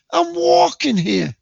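high, slow, loud street-tough yell; New York tough guy. Shown as a demonstration. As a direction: bark it at the traffic, stretched out. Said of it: "Got to have a bit of, you know, character".

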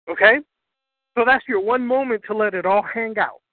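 ordinary talk, nothing marked

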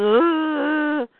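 imitated strained groan; acted groan. Clark going, groaning sound.

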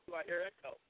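digital echo. Real, not acted. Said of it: "Why the hell do I hear echo?".

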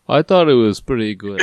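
accidental Canadian accent, badly faked; muddled Canadian accent. Host imagines Dolph Lundgren calling in, muddled Canadian accent. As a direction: imitate a caller in a wobbly, vaguely Canadian accent. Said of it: "I don't know what accent I'm going with".

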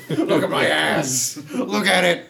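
high, drawn-out mock outcry with laughter around it; indignant demand. Showing her the gash, indignantly.

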